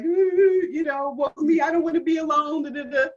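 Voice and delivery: high, loud, hammed-up wail of woe; a theatrical woe-is-me moan, not real sobbing; mock self-pity. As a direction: lampoon her old crying self. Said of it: "I started crying".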